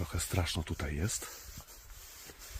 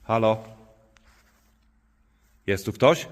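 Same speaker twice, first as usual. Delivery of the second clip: loud high calls; shouting; 4 semitones higher, 12 dB louder than usual. Real, not acted. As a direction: yell into the dark church. Calling out in empty church.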